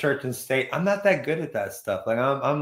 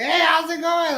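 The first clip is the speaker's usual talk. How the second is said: much higher squeaky greeting; high girly voice; 12 semitones higher than usual. Retold or imitated. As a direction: chirp it up high. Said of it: "the girl that was in Casper".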